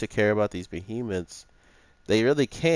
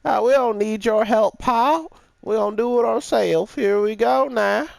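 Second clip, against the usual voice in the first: put-on old prospector; 14 semitones higher, 6 dB louder than usual. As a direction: ham up a grizzled old prospector. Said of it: "these stupid cowboy hat wearing anime characters to be like".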